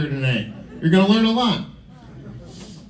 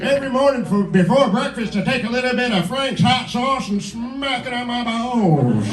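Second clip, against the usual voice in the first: put-on higher old-timer voice; centenarian giving advice; 5 semitones higher than usual. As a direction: play an ancient storyteller, drawing out the last word. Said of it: "be a hundred and seven".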